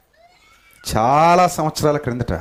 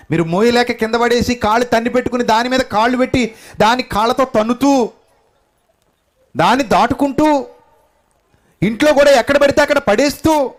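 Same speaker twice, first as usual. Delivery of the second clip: high, loud, rising rebuke from the pulpit; preacher scolding the congregation for neglecting the Bible, fiery preaching; 10 semitones higher, 4 dB louder than usual. Acted.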